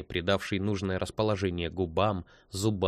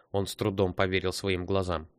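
usual speaking voice

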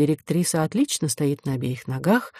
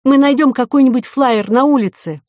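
raised, high-pitched urgent call; urgent cry; 7 semitones higher, 5 dB louder than usual. A narrator's reading. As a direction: call it out loudly on the run. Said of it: "возопила Ко". (взволнованный громкий возглас)

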